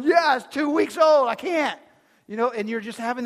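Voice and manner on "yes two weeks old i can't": exasperated shout. Retold or imitated. Exasperated dad answers restaurant crowd.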